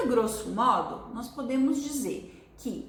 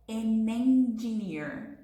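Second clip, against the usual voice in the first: "An engineer" drawn out slowly as one smooth sound; slow linked model; much slower than usual. Shown as a demonstration. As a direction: glide the n of "An" into "engineer". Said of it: "Faz toda a diferença".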